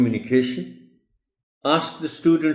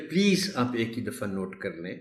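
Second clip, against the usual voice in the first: ordinary delivery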